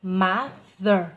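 "Mother" loud, with the middle th pushed out clearly; clear TH sound. Shown as a demonstration. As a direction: push out the th in "Mother". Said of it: "Làm rõ âm the này luôn này". ("Mother" đọc to, đẩy rõ âm th ra)

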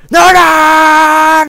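loud high call across the room. Real, not acted. Streamer calling a friend who can't hear him, loud and high. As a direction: shout the name to get his attention.

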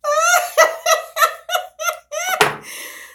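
very high wailing laugh; real tearful laughter; crying laughing. Real, not acted. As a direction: laugh till it turns to a cry. Laughing so hard she cries.

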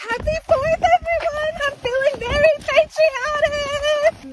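bright high cheer; excited. Acted. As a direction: greet everyone brightly. Cheerful holiday greeting.

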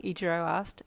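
usual speaking voice

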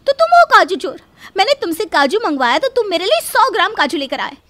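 loud, high screaming tirade; wife screaming at her cashew-thief husband, loud and high; furious accusation. Acted. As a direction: tear into him, voice cracking. Wife catches husband eating cashews.

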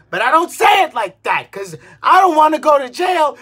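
usual speaking voice

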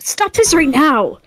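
shouting. Acted. Confronting a friend in character.

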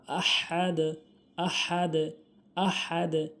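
আহাদ with a strong throaty h; big ha. Shown as a demonstration. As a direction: push h from mid-throat in আহাদ, bounce the d. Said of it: "বড় হা". (আহাদ বড় হা দিয়ে)

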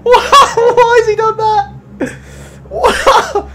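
shrieking with tearful laughter, high and loud; hysterical laughing. Real, not acted. Streamer cracking up at a rude driver name, shrieking laughter. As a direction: laugh till you cry, squeaking out the words. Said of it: "tears in my eyes".

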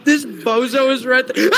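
slow, laughing through words; laughing while talking. Real, not acted. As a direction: laugh it out, half shouting. Laughing at chaser nearby.

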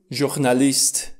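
"journalist" slowly, starting with French zh. Shown as a demonstration. Teacher gives a French-origin example, "journalist" with J like ajutor. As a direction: say "journalist" as "zhur-na-list". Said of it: "se pronunță ca în românescul ajutor".

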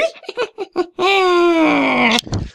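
high villain cackle; evil laugh. Acted. Evil guy's entrance laugh.

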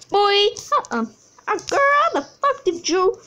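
high, loud mock scold at herself; mock self-scolding. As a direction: scold yourself like a sassy friend.

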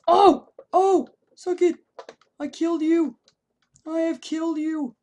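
high loud gloating; loud victorious taunt; triumphant shout. Real, not acted. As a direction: gloat over the kill. Gloating after a kill.

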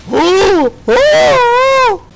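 high, loud shocked gasp then yelps; startled gasps. Real, not acted. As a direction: caught off guard, gasp and squeal. Startled by a sudden enemy.

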